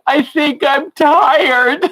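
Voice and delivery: put-on high, slow, whiny four-year-old voice; whiny little girl. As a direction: imitate a sleepy toddler admitting she's tired. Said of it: "she was getting all whiny".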